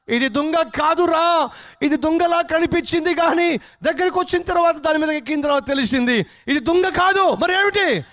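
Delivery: high, stretched-out yell; desperate shouting. Acted. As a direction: shout back in alarm from the water. Trapped man shouts back the truth. (భయంతో గట్టిగా అరుపు)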